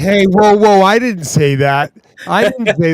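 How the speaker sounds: loud and high, playfully outraged; mock indignant protest. Real, not acted. Cutting off the cohost's joke, loud mock outrage. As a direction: jump in loud as if falsely accused. Cohost jokes he can't sing.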